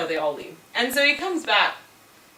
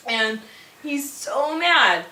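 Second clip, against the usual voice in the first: normal talking voice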